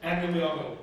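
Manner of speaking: normal talking voice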